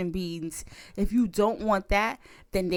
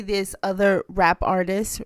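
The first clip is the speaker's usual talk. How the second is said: ordinary delivery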